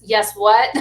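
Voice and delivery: loud mock-scolding demand; stern parent. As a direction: prompt a child for manners, loudly. Parent prompting child for manners.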